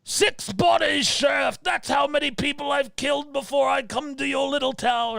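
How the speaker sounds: a high, shouted villain brag to the sheriff; menacing slasher shout. Pretend. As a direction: bellow it like a killer taunting the sheriff. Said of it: "Maybe he'd say something along the lines of".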